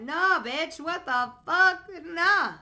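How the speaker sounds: high, loud outburst of refusal; loud indignant rebuke. Real, not acted. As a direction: shout it down, high and emphatic. Rebukes the imagined chronic complainer.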